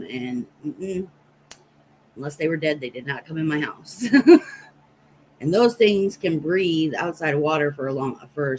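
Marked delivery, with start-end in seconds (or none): 4.1-4.6 sharp laugh. Real, not acted. Laughing about snakeheads.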